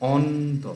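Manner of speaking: অন্ত said as on-to; ন closes first syllable. Shown as a demonstration. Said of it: "নটা কাটছে".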